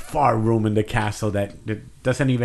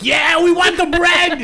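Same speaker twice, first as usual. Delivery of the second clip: high, loud, drawn-out yell; mob shout; 5 dB louder than usual. Acted. Crowd clamoring for gift bags of bread, mock rowdy shout.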